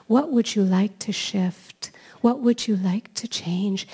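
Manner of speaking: slow, whispery healer voice; soft healing voice. Retold or imitated. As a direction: imitate a gentle healer, slowly and breathily. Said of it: "She's in a very soft, healing voice".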